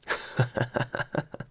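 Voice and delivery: soft, breathy run of giggles; long, hushed, tickled laugh; amused giggle. Real, not acted. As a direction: giggle quietly at your own joke. Laughs at grandpa's pants.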